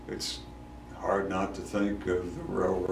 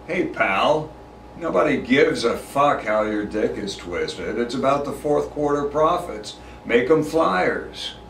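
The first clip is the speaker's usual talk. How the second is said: loud, raised-pitch corporate tough talk; brash headquarters talk; 6 semitones higher, 5 dB louder than usual. Acted. Wanting to stand up to a pal, brash and loud. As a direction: lay into a colleague, loud and high, dragging out the last words. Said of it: "is how we speak at world headquarters".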